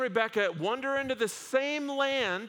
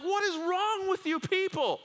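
exasperated king; 10 semitones higher than usual. Retold or imitated.

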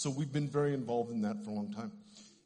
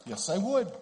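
rising excitement; 7 semitones higher, 8 dB louder than usual. Retold or imitated. Climax of healing story, voiced quotes.

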